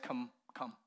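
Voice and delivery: soft, inviting come; Jesus quietly beckoning Peter; gentle invitation. Shown as a demonstration. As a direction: coax him over kindly. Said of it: "the spirit or the tone with which Jesus said".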